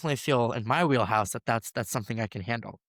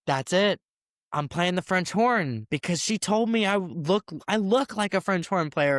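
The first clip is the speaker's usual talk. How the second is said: put-on thrilled kid; 6 semitones higher than usual. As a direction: relive a sixth-grader's sudden certainty. Thrilled kid picks French horn.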